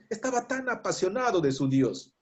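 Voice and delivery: ordinary talk, nothing marked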